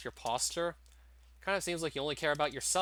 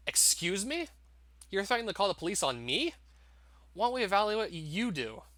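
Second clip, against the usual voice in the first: higher, rising indignant challenge; indignant disbelief; 6 semitones higher than usual. Acted. Boyfriend to abusive father, higher indignant disbelief. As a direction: throw the accusation back at him.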